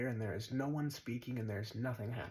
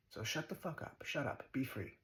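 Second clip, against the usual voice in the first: normal talking voice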